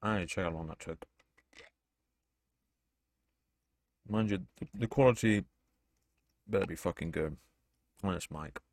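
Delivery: talking while chewing gum; words round a wad of gum. Real, not acted. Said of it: "I'm chewing gum right now".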